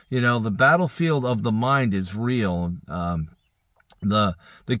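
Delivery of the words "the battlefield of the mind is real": ordinary delivery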